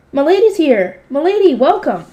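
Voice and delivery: high, loud little helper voice; high-voiced greeting. A narrator's reading. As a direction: pipe up eagerly to greet your lady. Library helpers greet their lady.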